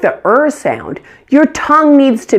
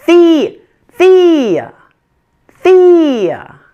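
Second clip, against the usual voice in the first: high, punched "the", then "thea" falling to a weak schwa; exaggerated first-syllable stress; 9 semitones higher, 4 dB louder, much slower than usual. Shown as a demonstration. Teacher showing learners the difference, exaggerated strong-weak stress. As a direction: stretch the start of "thea" high, drop onto the weak end. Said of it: "Notice the difference?".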